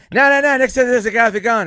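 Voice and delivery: high, loud, panicky insistence; Norm. Retold or imitated. Friend warning the green room, mock-panicked insistence. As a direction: mimic someone frantically overriding a denial.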